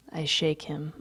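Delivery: ordinary delivery, nothing marked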